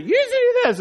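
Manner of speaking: mock a bird re-enacting an argument in a high voice; yelling parrot. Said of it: "he'll reenact it".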